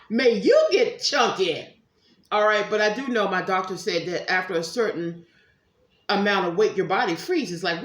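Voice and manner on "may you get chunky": slow, loud, laughing curse; playful mock curse. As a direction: lay on a teasing hex while laughing.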